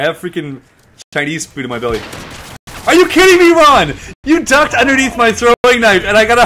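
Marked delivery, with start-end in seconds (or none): 2.9-6.2 high, loud disbelieving yell; outraged yell. Real, not acted. Gamer yelling at Ron who killed him, outraged. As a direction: yell it at your friend.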